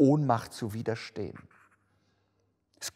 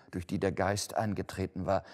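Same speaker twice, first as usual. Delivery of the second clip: usual speaking voice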